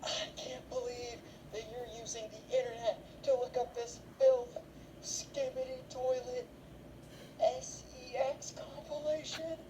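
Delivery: high, slow mock-scandalized mom voice; a hammed-up shocked mother scolding; a hammed-up mom scolding her son for his search history; outraged mom. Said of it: "The mom is like".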